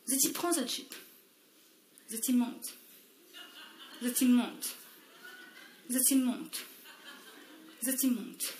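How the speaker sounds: softly calling the moves for the dancers; hushed choreographer cue; soft demo count. Shown as a demonstration. Choreographer hushing the dancers through the skirt moves, soft. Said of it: "ce que m'y propose Zot".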